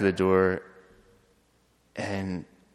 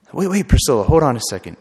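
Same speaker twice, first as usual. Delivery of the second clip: higher, louder urgent wait; urgent call; 5 semitones higher, 8 dB louder than usual. Retold or imitated. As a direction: call out to stop her quickly. Stopping her sitting on floor.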